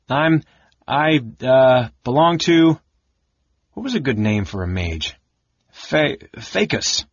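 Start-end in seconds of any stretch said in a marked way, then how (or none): 0.1-2.8 high, loud, halting squeak; squeaky. A narrator's reading. Mouse-bodied Tas improvising an answer to the mage, squeaky and halting. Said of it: "Ta squeaked". 5.7-7.0 high, slow, stammered squeak; squeaky. A narrator's reading. Tas blurting a borrowed mage name, squeaky and stammering. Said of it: "Ta squeaked".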